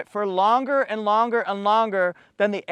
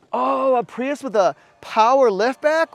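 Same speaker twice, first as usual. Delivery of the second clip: surprised; 5 semitones higher, 4 dB louder than usual. Real, not acted. Discovers the power liftback.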